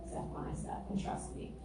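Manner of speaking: whispered, as God speaking softly; hushed whisper. Retold or imitated. God whispering guidance to her in the dark. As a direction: breathe it like a secret promise. God's quiet instruction voiced.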